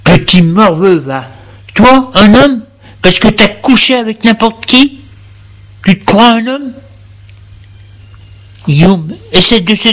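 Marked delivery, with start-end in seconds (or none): 0.0-6.7 mock him with contempt, pitched up; furious jeering father; scornful father. Father mocks son in fight.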